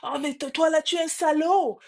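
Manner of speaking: high-pitched mock outburst; imitated child's anger. Boy lashes out at the unknown voice, mock angry. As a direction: voice a frustrated boy hurling an insult. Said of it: "Il se fâche".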